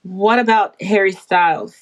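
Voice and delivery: normal talking voice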